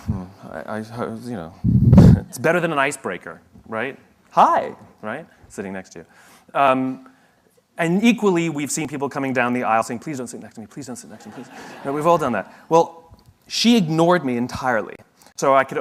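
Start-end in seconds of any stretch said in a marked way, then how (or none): 4.3-4.7 a high, loud, overeager hi; mock bright greeting. New seatmate greeting the passenger beside her, hammed-up and loud. 10.0-11.5 softer plea, fading quieter on the repeat; a half-hearted hushed prayer under the breath; mock muttered plea. Passenger hoping nobody takes the seat, mock hushed pleading.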